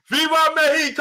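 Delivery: high, excited cry; hype shout. Shown as a demonstration. Streamer showing how he would have hyped the crowd. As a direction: belt it out like a hype man. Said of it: "Then I would have said".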